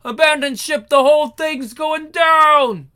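urgent yelled warning; shouting. Acted. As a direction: yell it as the ship sinks. Ship sinking, crew fleeing.

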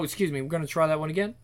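ordinary delivery, nothing marked

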